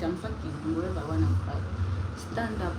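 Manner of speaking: normal talking voice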